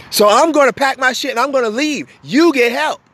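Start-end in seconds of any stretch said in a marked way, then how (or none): none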